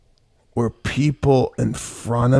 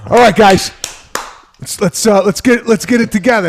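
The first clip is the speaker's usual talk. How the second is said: mock pep talk; 10 semitones higher, 8 dB louder than usual. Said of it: "I go".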